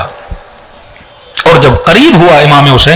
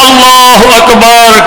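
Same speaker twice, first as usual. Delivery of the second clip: "Allah Akbar" pitched much higher and drawn slow; a soaring repeated cry of "Allah Akbar"; high ringing cry; 8 semitones higher, much slower than usual. Acted. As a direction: call "Allah" out high, stretched, twice. All three cry out together.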